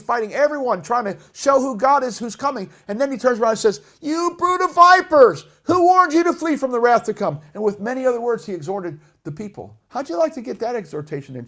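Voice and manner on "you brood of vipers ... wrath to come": enraged rebuke. Acted. John the Baptist rebuking the crowd, enraged. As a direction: thunder it like an angry prophet. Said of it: "He said it with the passion and the enraged".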